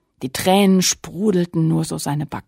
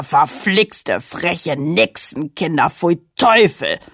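angry scolding king; 4 dB louder than usual. Acted. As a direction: scold them like a furious giant king. King scolds caught children.

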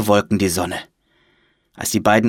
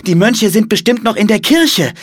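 put-on high, loud excited child; urgent realisation; 12 semitones higher, 6 dB louder than usual. A narrator's reading. Girl realises where the monks are, urgent. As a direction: blurt out the idea in a high voice.

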